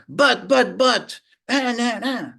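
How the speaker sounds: mock combative protest; adversarial objection. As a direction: argue back over them. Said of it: "more adversarial role".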